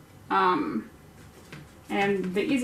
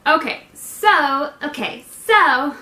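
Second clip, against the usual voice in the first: slow, much higher and louder okay so; goofy drawn-out warm-up; 5 semitones higher, 8 dB louder, slower than usual. Shown as a demonstration. Host fooling about before the take. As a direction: stretch it out, clowning around. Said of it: "What is wrong with me today?".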